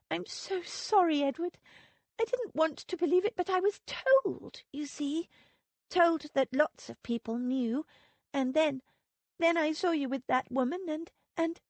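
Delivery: a hint of crying as she stumbles through it; tearful apology. A narrator's reading. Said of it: "Tears rolled down her cheek".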